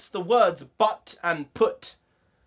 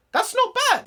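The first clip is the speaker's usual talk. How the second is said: pleasantly surprised; 4 dB louder than usual. Real, not acted. Pleased at the quiz result.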